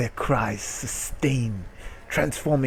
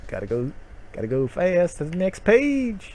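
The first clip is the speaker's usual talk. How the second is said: mock speedster; 7 semitones higher than usual.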